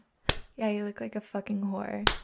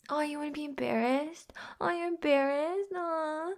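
higher, cooing taunt; mock sympathy; 4 semitones higher than usual. Teasing the embarrassed listener, mock sympathy. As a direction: coo with fake pity.

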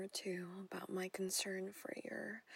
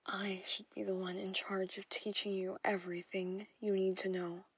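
ordinary talk

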